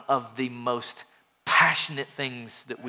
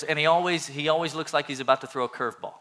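usual speaking voice

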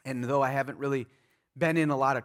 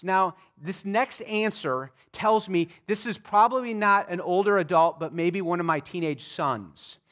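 ordinary talk

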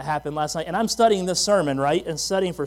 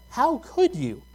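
high-pitched, affronted accusation; mock indignation; 8 semitones higher, slower than usual. Shopper to man who nearly hit her cart, mock indignant. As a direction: imitate an offended shopper's reproach. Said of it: "gives me the look like".

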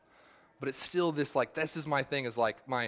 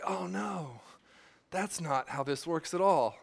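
put-on slipping into a mild British accent, higher, slow at the end; 4 semitones higher, slower than usual. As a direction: say it like a shocked British vicar. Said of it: "weird British thing right there".

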